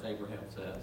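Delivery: normal talking voice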